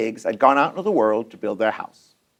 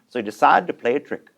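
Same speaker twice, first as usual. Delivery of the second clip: ordinary delivery, nothing marked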